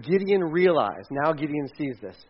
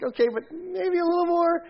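high, wheedling ask for more; pushy Gideon; 13 semitones higher than usual. Retold or imitated. Gideon bargaining with God, in a high wheedling voice. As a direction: coax God for one more sign. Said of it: "He's like".

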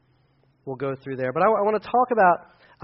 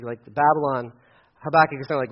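ordinary talk, nothing marked

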